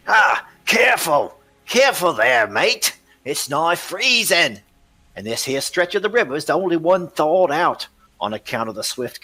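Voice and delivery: high, loud rough boatman calling to his mate; a cocky river smuggler, pleased with himself; gruff smuggler. Acted. Boatman warns his mate about the icy river, high and loud.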